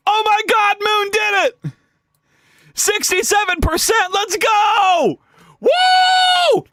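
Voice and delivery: high-pitched cheer building to a whoop; excited celebration. Real, not acted. Celebrates reaching sixty-seven percent.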